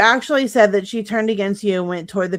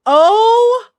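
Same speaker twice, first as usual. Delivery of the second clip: shocked cry; 14 semitones higher, 12 dB louder than usual. Real, not acted. Reacting to shocking clip.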